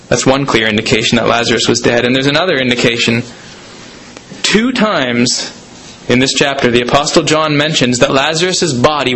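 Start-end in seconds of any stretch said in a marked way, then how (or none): none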